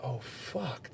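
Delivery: very soft, deflated mutter; stunned hush. Retold or imitated. Man realizing his friend is skinnier now, stunned hush. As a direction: say it under your breath as it sinks in.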